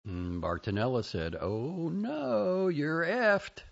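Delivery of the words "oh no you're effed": high, rising singsong taunt; louder, high-pitched mock alarm; mock-dismayed alter ego. A narrator's reading. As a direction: draw out the oh no, climbing high. Bartonella gloats at the narrator's trouble.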